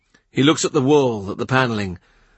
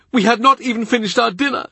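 much higher grieving servant's voice; tearful Cavendish; 12 semitones higher, faster than usual. A narrator's reading. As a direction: lift it high like a tearful old retainer. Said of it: "He cries as he talks".

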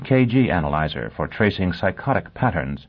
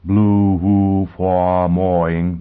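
words stretched out on one level tone; slow-motion speech; 4 dB louder, much slower than usual. A narrator's reading. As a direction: drag the syllables like slowed-down speech. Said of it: "stepped to the slow-motion figure".

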